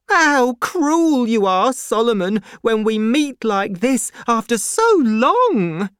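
high, loud, wounded reproach; crying out. A narrator's reading. Miss Pross reproaches Solomon after so long, crying out hurt. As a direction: wail it high at a cruel brother. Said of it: "cried Miss Pross".

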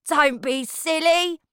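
high and slow, scoffing; scornful. Acted. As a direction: wave away a silly idea. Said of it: "he replied".

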